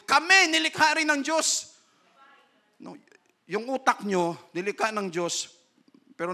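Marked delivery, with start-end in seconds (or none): 0.1-1.8 mock outcry. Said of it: "sisigaw yung mga tumboy".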